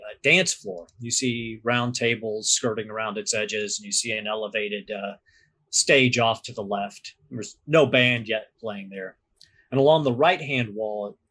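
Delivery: normal talking voice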